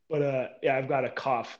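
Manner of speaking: ordinary talk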